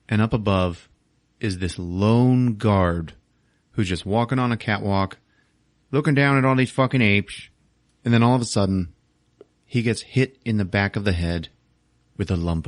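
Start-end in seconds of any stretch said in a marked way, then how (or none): none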